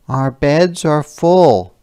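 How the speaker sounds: refusing wife. Acted. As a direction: shut them out.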